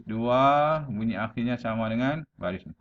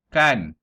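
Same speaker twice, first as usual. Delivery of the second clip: "kan" punched out louder on its own; isolated, emphatic "kan" to show the rhyme; rhyme ending stressed; 3 semitones higher, 8 dB louder than usual. Shown as a demonstration. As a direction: hit the lone syllable "kan" firmly, louder. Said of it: "tengok ni intonasi".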